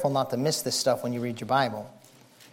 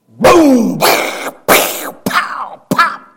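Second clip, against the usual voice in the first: thunder booms; 11 semitones higher, 10 dB louder, much slower than usual. Retold or imitated. Preacher imitating thunder cracks.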